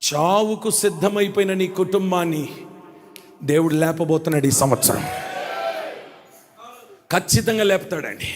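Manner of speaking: usual speaking voice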